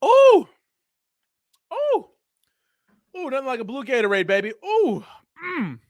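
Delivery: high delighted squeals; excited ooh. Real, not acted. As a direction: squeal with pleasure after a sip. Exaggerated delight drinking Gatorade.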